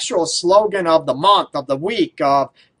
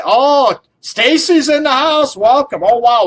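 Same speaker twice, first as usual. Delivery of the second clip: high, loud, delighted welcome; light up as a friend walks in; excited greeting; 8 semitones higher, 5 dB louder than usual. Real, not acted. Host welcoming Stacy into the studio, excited and loud.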